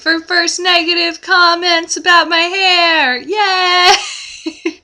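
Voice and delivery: high squealing mock hooray; sarcastic cheer. Real, not acted. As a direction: cheer like it's a prize you won. Mock celebrating a negative comment.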